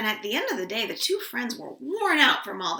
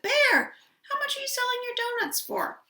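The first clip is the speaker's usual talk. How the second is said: higher voice calling over to the next stall; Pig calling across; 13 semitones higher than usual. Acted. Pig calls across the market to Bear, higher. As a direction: call out to Bear, pitched up.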